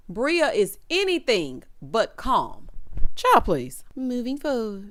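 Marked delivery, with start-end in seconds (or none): none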